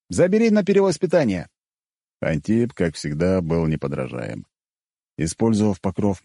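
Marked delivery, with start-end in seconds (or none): 0.1-1.5 high, loud call. Acted. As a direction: shout across to him. Calling out to Antip.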